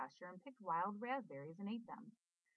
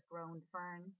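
ordinary delivery

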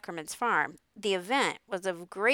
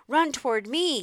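raised, high gander call; yelling gander; 6 semitones higher, 5 dB louder than usual. A narrator's reading. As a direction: yell it out like a gander. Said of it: "yelled the gander".

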